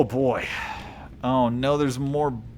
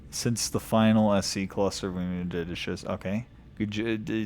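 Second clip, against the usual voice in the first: ordinary delivery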